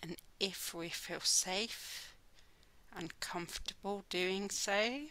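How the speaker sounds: slow, soft meditation voice with long pauses; guided meditation. Real, not acted. Teacher guiding listeners to close their eyes and listen, slow meditation voice. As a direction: ease them gently inward, unhurried, drawing out the words.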